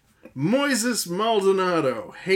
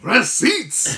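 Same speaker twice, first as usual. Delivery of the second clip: louder announcer call on Receipts; announcer shout; 4 semitones higher, 7 dB louder than usual. Acted. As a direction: call out Receipts with a big fall. Bigger segment call.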